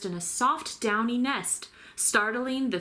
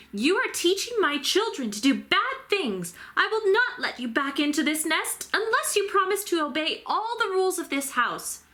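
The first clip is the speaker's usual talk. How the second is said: higher, firm scolding voice; stern mother bird; 9 semitones higher than usual. A narrator's reading. Mama Bird warning Stellaluna, stern and higher. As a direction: lay down the law as a strict mother.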